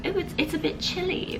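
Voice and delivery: high, soft put-on voice; mock shivery. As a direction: play it up, pretending to be cold. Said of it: "It's like".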